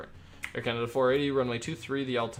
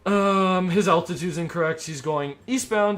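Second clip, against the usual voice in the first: higher, much louder explaining voice; loud announcement; 6 semitones higher, 8 dB louder than usual. Real, not acted. Controller explaining a wrong altitude to viewers, loud and raised. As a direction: speak up clearly for the audience.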